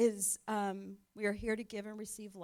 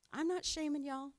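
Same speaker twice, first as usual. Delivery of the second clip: playful teasing nudge; 5 semitones higher, 4 dB louder than usual. Acted. Playful nudge to attend group.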